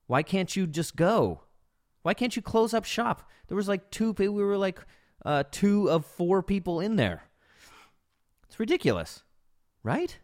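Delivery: high-pitched, exasperated disbelief; can't believe anyone would stay, pitch climbing; incredulous rant. Real, not acted. Ranting about the waitress staying.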